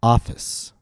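teacher's model of "Office", front-stressed; stress on first syllable. Shown as a demonstration. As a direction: lift and stretch the first vowel of "Office". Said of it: "the vowel ah is long, and my pitch rose on ah".

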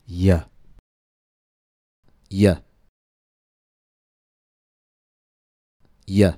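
"y" said as an isolated "yuh", gliding from ee; "y" as a lone gliding consonant for repeating, long pauses between; y sound model. Shown as a demonstration. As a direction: say "y" as "yuh", an ee in motion with no stop. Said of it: "Please listen and repeat the consonant".

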